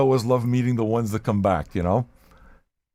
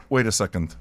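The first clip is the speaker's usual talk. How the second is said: ordinary talk, nothing marked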